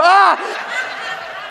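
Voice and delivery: high-pitched mock shriek; startled scream. Man recoiling from dog's tongue, comic startled scream. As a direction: yelp in comic horror. Acting out waking to dog's licking.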